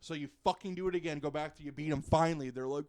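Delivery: ordinary delivery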